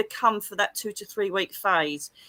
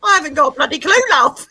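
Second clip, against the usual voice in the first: cheeky loud retort; 6 semitones higher, 11 dB louder, faster than usual. Retold or imitated. Her own cheeky retold reply.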